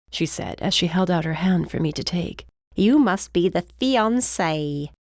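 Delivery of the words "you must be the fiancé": put-on slow, delighted British accent; drawn-out, knowing British greeting; British housekeeper accent. A narrator's reading. Housekeeper greets the presumed fiancé, British accent.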